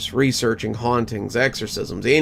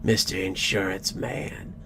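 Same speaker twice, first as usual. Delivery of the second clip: raspy ghost voice. A narrator's reading. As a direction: rasp it like a vengeful ghost. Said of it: "Ezekiel's raspy voice echoed around me".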